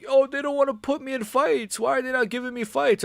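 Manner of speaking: high, whiny put-on complaining voice; mock the fighter's whining.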